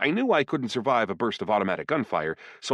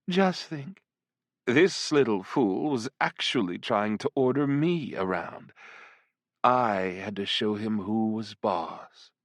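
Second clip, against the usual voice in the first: slow, teasing demon seductress; flirtatious demoness; slower than usual. Acted. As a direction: purr each threat slowly and playfully. Voices the succubus.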